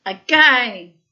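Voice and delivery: booming high command; God demanding another laugh; loud call. A narrator's reading. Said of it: "cried God".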